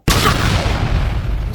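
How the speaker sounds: loud, explosive "pow"; punch sound. Acted. Reader cheering Donatella's accusation, loud punch noise. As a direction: burst it out like a hit. Cheering each verbal hit.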